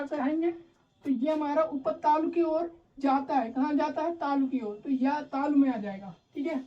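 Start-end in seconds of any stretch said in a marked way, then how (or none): none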